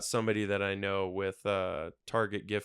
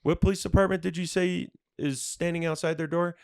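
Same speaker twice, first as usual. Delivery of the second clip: higher, pushier demand; retold challenge to a phone scammer; confronting the scammer; 7 semitones higher, 4 dB louder than usual. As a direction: press the caller hard. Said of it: "I was like".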